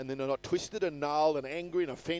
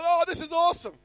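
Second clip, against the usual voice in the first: mock delight; 11 semitones higher, 6 dB louder than usual. Mock believer when things go well.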